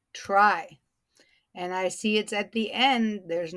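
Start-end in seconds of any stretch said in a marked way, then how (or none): 0.1-0.8 said short. Shown as a demonstration. Teacher showing a short word, TRY said quickly. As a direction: TRY in one quick beat. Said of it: "it's short".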